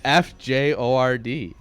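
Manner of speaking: slow letter by letter; spelling out slowly. Shown as a demonstration. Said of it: "it's spelled fjord".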